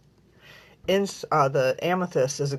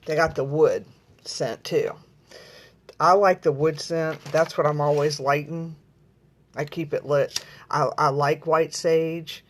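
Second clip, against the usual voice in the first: ordinary talk, nothing marked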